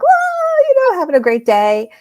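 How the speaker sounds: a giddy, soaring whoa of delight; excited whoa. Retold or imitated. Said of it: "I'm like".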